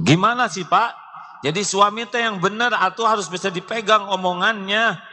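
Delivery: wife nags her silent husband, high and sharp; nagging wife. Acted. Wife complaining to her husband, high-pitched scolding.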